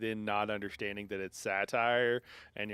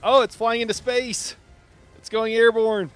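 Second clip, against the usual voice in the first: high, loud amazed yelling; excited shout; 12 semitones higher, 14 dB louder than usual. Real, not acted. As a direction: shout in delighted disbelief. Enemy flung into sky.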